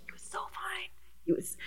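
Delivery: hushed, breathy confession of a crush; whispering. Real, not acted. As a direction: whisper it like a guilty secret. Whispering a secret crush's name.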